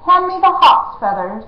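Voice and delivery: high, loud command; imperious. Acted. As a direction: act the prince. Jemmy demands quill.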